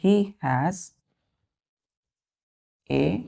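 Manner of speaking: very slow, long gaps between words; one word at a time, waiting after each; word-by-word dictation. Shown as a demonstration. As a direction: dictate it for pupils to copy. Dictating example sentence word by word.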